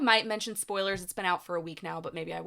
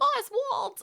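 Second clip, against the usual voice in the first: high shrill call of Oswald's mother; mom calling out. Retold or imitated. Imitating the Penguin's mother calling her son, high call. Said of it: "His mom is always like".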